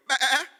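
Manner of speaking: loud, high imitated bleat; comic "baa"; sheep bleat. Shown as a demonstration. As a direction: bleat like a lamb. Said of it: "we're gonna go".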